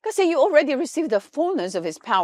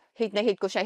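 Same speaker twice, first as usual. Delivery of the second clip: usual speaking voice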